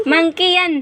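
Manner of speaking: usual speaking voice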